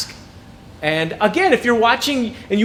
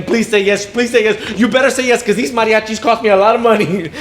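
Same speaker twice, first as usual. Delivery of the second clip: pleading a little louder, laughing at the end; mock begging; 3 semitones higher, 5 dB louder than usual. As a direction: beg her, half joking, on both knees. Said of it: "'cause I was begging".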